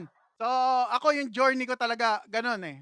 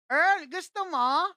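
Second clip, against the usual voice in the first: put-on slow, higher sing-song tease from the neighbor girl; teasing offer; 5 semitones higher, slower than usual. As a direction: dangle the chocolate at him, drawn out. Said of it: "Sabi niya sa akin". (panunukso, mabagal at pakanta)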